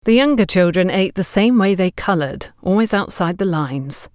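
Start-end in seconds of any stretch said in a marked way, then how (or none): none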